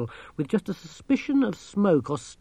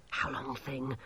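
hissed; 12 dB quieter than usual. A narrator's reading. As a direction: hiss it very softly. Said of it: "Masklin hissed".

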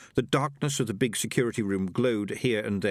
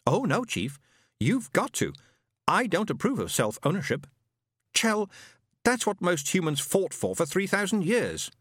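higher, precise machine voice; pompous robot; 6 semitones higher than usual. A narrator's reading. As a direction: list it like a know-it-all robot. Robot Isaac speaks.

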